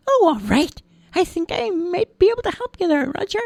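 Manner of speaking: very high squeaky little voice; chirpy tiny elf; tiny man voice. Acted. Tiny man greets crying Roger, squeaky high.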